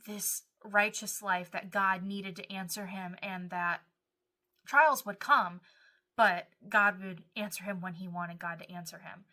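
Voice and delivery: ordinary talk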